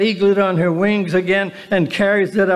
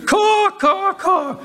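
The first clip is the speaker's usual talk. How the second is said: high mock crow caws; 12 semitones higher, slower than usual. Preacher mocking worldly crows with high caws. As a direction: caw like a crow, pitched way up.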